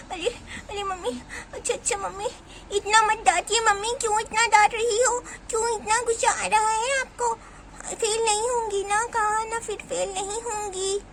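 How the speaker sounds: put-on high, tearful child's whine; pleading little girl. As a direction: whine to mother not to scold. Child pleads against scolding. (रुआँसी आवाज़ में मनाती बच्ची)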